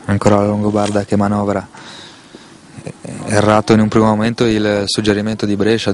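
ordinary talk

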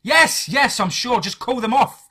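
high, loud desperate plea; frantic screamed surrender; screaming. A narrator's reading. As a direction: yell to make it stop. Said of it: "I screamed".

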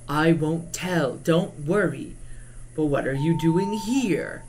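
slow, drawn-out ancient creature; old, ancient voice. Acted. Ancient creature reassuring the runaway girl, slow old voice. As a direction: take your time like an ancient being. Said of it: "Let me give him a more old, ancient voice".

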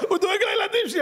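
high laughing mockery. Real, not acted. Preacher to audience, laughing at the salesman's claim. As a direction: laugh it out in disbelief.

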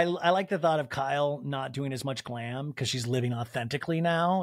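ordinary talk

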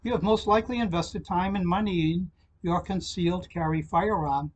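usual speaking voice

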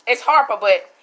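normal talking voice

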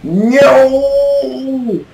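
very high, loud, held-out cry; drawn-out shriek of dismay; long wailed no. Real, not acted. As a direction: stretch the word into one long high wail. Crying out during game fight.

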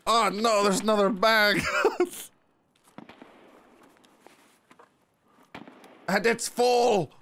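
thrilled high voice; excited, high. Real, not acted. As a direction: squeal with joy at the loot. Excited looting, laughing.

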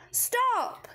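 high shouted plea; crying out. A narrator's reading. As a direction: yell it to make her stop. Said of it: "he cried out".

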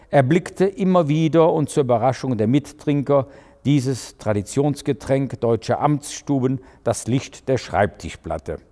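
plain reading voice

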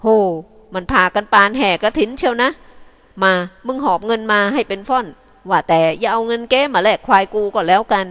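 loud, cheeky call about the crowd; calling out teasingly. A narrator's reading. Thit Chantha calling to his mates as villagers arrive, loud and cheeky. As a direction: tease the approaching villagers.

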